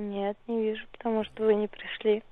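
usual speaking voice

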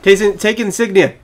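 ordinary delivery

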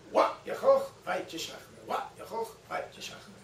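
whispered rehearsal of a Klingon line; muttering practice. Retold or imitated. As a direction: murmur the line over to yourself. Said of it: "Christopher Lloyd practiced the line".

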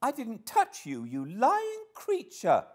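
very high, loud angry denial; high-pitched girl's furious accusation; indignant cry. A narrator's reading. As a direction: cry out in shrill-pitched outrage, slowing on the insult. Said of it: "cried she".

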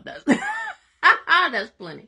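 high, loud, slow laugh through the words. Real, not acted. Laughing at own sermon.

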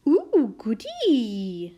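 loud, drawn-out delight; gleeful relish. A narrator's reading. Mum coaxing princess to eat eggs, gleeful. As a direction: savour it slowly with glee.

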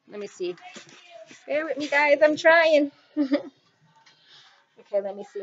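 ordinary delivery

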